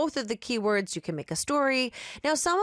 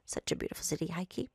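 soft aside to herself; half whisper; 3 semitones lower, 9 dB quieter, faster than usual. Acted. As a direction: murmur it almost unvoiced. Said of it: "she half whispered to herself".

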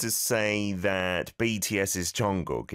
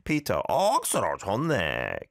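Busan accent; 2 semitones higher, faster than usual. Shown as a demonstration. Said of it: "You could change Busan for anything".

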